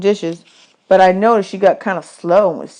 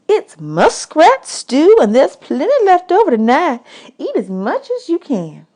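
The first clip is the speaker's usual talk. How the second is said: higher, mock-grand woman's voice; woman at camp; 8 semitones higher than usual. Camp woman teasing boys about their tin china, higher. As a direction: serve it like fine china, teasing.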